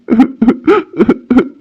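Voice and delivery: short, broken sobbing laugh; tearful chuckle. Acted. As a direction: let out a choked little laugh. Man tearful remembering family.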